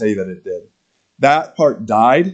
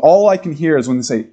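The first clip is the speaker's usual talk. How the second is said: ordinary delivery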